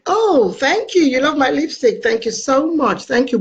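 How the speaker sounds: high-pitched, gushing surprise at a compliment; delighted thanks. Real, not acted. Host thanks a viewer for praising the lipstick, delighted. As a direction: gush with real delight, pitched well up.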